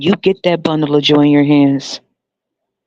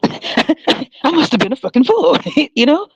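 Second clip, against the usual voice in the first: laughing; 11 semitones higher than usual. Real, not acted. Laughing through sarcastic confession.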